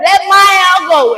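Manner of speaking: high, loud mock holler; loud, high-pitched demand; acted screaming. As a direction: re-enact screaming at someone pulling your hair. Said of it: "I'm screaming".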